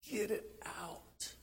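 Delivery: breathy urgent whisper, slow; desperate whisper. Acted. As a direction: hiss it out like someone in pain. Voicing desperate plea for relief.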